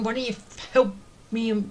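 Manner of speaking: normal talking voice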